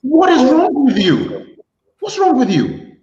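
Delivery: high, loud, slow exasperated yell; exasperated outburst. Real, not acted. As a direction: blow up at a friend who ruined it. Host exasperated at guest live on air.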